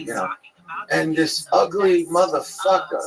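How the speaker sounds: ordinary talk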